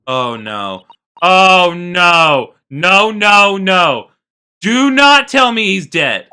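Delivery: loud, slow, rising despair; anguished outcry. Acted. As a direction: cry out that he can't be dead. Susato's grief outburst.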